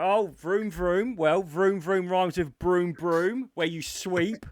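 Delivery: loud, slow riddle explanation; quiz host explaining. Retold or imitated. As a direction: spell out the absurd clue like a hammy host. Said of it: "And they'll go".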